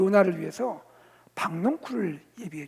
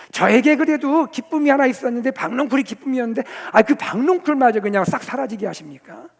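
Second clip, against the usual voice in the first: loud, pitched-up protest; angry complaint; 4 semitones higher, 8 dB louder than usual. Acted. Preacher as prophet protesting the lost gourd vine, angrily. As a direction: complain to God, voice raised and hurried. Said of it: "하나님을 향해 화를 냅니다".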